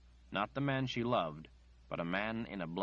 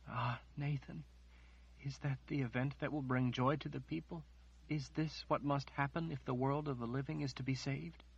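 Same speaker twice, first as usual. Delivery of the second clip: put-on soft, higher young woman's voice, trembling with horror; Margaret, shaken and pleading; 6 semitones higher, 4 dB quieter than usual. Margaret asks Nathan, stunned and pleading softly. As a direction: ask it quietly, as if still in shock.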